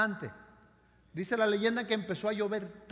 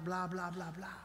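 mock empty prayer; 2 semitones lower, 6 dB quieter, slower than usual. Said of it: "nos vamos igualito que llegamos".

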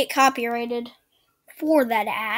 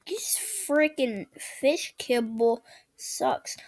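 slow whispered gripe; 5 dB quieter, slower than usual. Real, not acted. Gamer whispers a slow gripe about the fish kibble. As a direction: breathe it out slowly, no voice.

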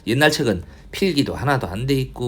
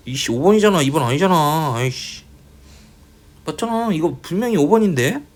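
higher-pitched exasperated protest; frustrated and pitched up; frustrated insistence; 5 semitones higher than usual. Real, not acted. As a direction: insist you're right, voice rising. Insisting on own answer, frustrated.

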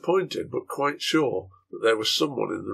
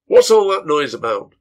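annoyed shout; 4 semitones higher, 11 dB louder than usual. Acted. Green shouts through closed door.

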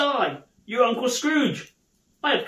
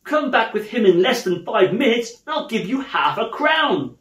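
loud, emphatic, excited orders; eagerly in earnest; 5 semitones higher, 6 dB louder than usual. Acted. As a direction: urge the boy on with real insistence. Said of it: "I am in earnest".

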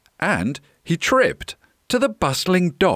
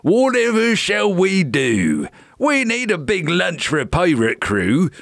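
pirate captain; 4 semitones higher than usual. A narrator's reading. Captain needs food for his crew, pirate voice. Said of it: "said the captain".

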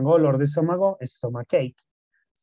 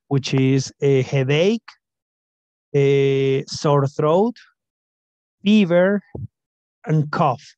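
slow teaching read; 6 dB louder, much slower than usual. Shown as a demonstration. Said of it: "reading symptoms slowly".

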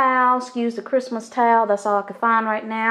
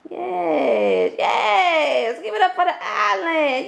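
cheering; 7 semitones higher, slower than usual. Real, not acted. Celebrating her new kitchen island.

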